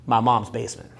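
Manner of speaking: low-pitched, unashamed deadpan; deadpan confession. Shown as a demonstration. Man answers woman where he lives, low deadpan. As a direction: drop it low, no apology.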